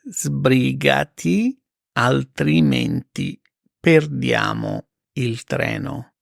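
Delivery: very slow, spaced repeat; slow it right down for learners; careful slow teacher read; slow and clear. Shown as a demonstration. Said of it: "slow repeat for learners".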